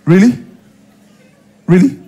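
short rising challenge of disbelief; scornful exclamation. Acted. Partner refusing to talk, exclaiming in scornful disbelief. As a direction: throw it back instead of answering. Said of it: "It's the exclamation".

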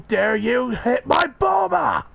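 high, slow anime shout; Vegeta shout. Retold or imitated. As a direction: yell it like an angry anime prince. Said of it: "he was like".

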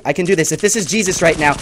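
ordinary delivery

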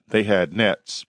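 plain reading voice

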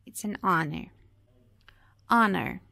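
"honor" with the H left out, starting on the vowel; silent H. Shown as a demonstration. Teacher repeats the example, silent H in "honor". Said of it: "o H não está na transcrição".

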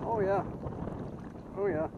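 loud, high cry of delight; real thrill at the catch; excited whoop of delight. Real, not acted. Landing a fish.

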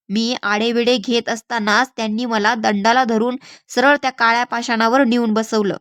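ordinary delivery

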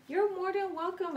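high, grateful you're welcome. Real, not acted. Answering a viewer's thanks, a high touched welcome.

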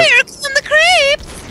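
high, drawn-out yell; shouted warning. Real, not acted. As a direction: call out the alarm in a high, slow shout. Calling out in game.

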